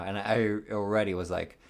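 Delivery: normal talking voice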